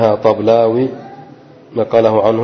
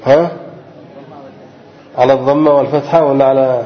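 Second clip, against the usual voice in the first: ordinary delivery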